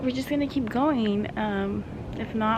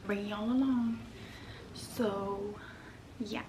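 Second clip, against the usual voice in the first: whispering; 8 dB quieter than usual. Real, not acted. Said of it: "don't mind me whispering".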